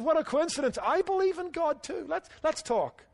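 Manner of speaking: high-pitched, eager discovery of common ground; mock delighted surprise. Rescuer to man on the bridge in a joke, mock delighted.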